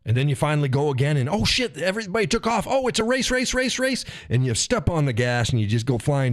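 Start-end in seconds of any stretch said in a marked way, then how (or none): 1.3-4.0 high, hammed-up panic at everyone racing off; mocking a driver who panics and floors it; mock panicked driver. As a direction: play a frantic driver chasing the pack.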